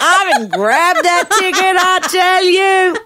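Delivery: very high, loud, drawn-out crowing; triumphant exclamation. Retold or imitated. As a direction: whoop it slowly, showing off the winning ticket. Triumphant climax of ticket story.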